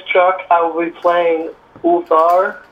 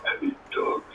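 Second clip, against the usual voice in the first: cursed slow-jawed voice; 16 dB quieter than usual. Shown as a demonstration. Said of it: "he talks very slow".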